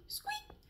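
a shy little squeak; mouse squeak. Acted. Mouse squeaking nervously at the lion, tiny high squeak.

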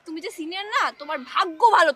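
ordinary talk, nothing marked

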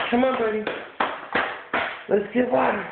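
high pet voice coaxing the dog; sweet high-pitched encouragement; high coaxing call. Real, not acted. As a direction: lure the puppy toward water.

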